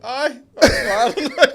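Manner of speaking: high, loud, drawn-out mock agreement; humouring agreement. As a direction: play along far too eagerly, stretching it out. Passenger humouring a ranting driver.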